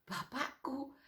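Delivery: soft, high rising "my father?"; stunned disbelief. Retold or imitated. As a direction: gape in disbelief. Stunned hearing what father said.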